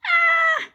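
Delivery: very high, loud yelp; frightened scream. Acted. Boy is seized by the ghost, frightened scream. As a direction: shriek as if grabbed.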